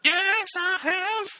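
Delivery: very high, slow robotic falsetto; sing-song auto-tuned robot voice. Pretend. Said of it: "Mister Gibbs".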